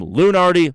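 LUNARDI as loo-uh-NAR-dee, four syllables; alternate pronunciation. Shown as a demonstration. Podcaster trying out the name Lunardi as loo-uh-NAR-dee. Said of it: "you know how I'm saying it".

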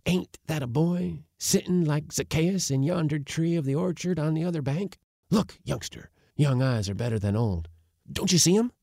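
put-on high, eager old man voice; excitable old uncle. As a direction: peer across the river, keen. Uncle's voice in the story.

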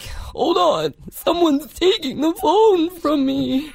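high, slow creepy character voice; Other Mother. Retold or imitated. Alter ego losing the phone to mom, high protesting put-on voice. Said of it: "and then she would be like".

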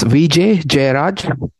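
"V J Jairaj" slowly, each name clearly separated; exact name pronunciation. Shown as a demonstration. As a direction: demonstrate "V J Jairaj" slowly, ending on a plain "raj". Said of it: "tell me the exact right pronunciation".

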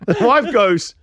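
normal talking voice

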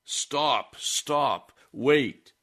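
a man on horseback yelling at the wagon to stop; retold yelling.

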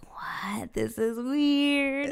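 drawn-out, high, whispery wonder; hushed, stretched-out retold amazement; mock bewilderment. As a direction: re-enact being puzzled, very slowly. Retold puzzled reaction on the trail.

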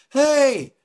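high call up from the road. Retold or imitated. Stranger on the road calls down to the crash, calling out. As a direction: hail someone far below.

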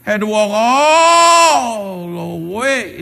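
"all" stretched very long, swelling and falling; slow, wailed "all the way"; drawn-out ALL. Retold or imitated. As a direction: stretch "all" out to show how far it was. Exaggerating long walk from parking.

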